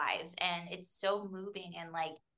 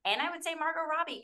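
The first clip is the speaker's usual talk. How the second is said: ordinary delivery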